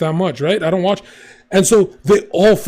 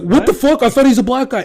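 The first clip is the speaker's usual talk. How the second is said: imitating a shocked chatter; 7 semitones higher, 4 dB louder than usual. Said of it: "the other guy's like".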